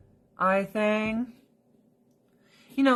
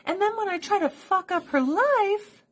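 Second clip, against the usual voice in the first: much higher, mock-innocent cat; scheming cat voice; 11 semitones higher than usual. Owner voicing cats learning the rules, high and sly. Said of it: "They'll be like".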